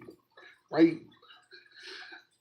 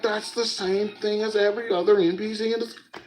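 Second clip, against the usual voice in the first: higher, sarcastic exasperation; exasperated; 8 semitones higher than usual. Retold or imitated. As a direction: roll your eyes at it. Mocking friend's description.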